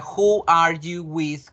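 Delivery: slowed right down, every word laid out for the class; slow teaching demo. Shown as a demonstration. Teacher asking Gabriela the model question, slow and deliberate. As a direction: spell the question out slowly for a student. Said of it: "si yo te pregunté a ti, Gabriela".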